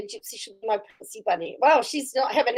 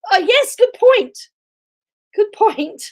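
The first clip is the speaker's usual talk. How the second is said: delighted agreement; 9 semitones higher, 7 dB louder, slower than usual. Real, not acted. Agreeing with a chat comment.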